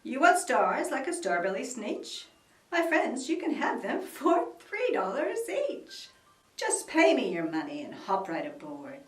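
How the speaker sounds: loud showy huckster voice; salesman pitch. Acted. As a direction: sell it like a carnival barker. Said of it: "And he said".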